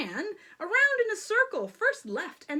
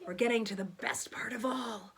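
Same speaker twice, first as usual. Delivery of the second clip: whispered aside; 4 semitones lower, faster than usual. Real, not acted. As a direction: whisper it like a secret.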